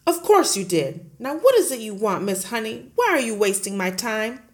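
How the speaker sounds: loud, booming headmistress; bully the teacher at full volume; Trunchbull bullying. Acted. Headmistress roaring at Miss Honey, loud and bullying.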